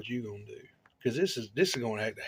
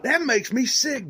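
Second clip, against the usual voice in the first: louder, slow, hammed-up griping; loud mock disgust; 10 semitones higher, 6 dB louder than usual. Joking to his friend about the sway bars: louder mock disgust. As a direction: ham up the jealousy, louder and slow.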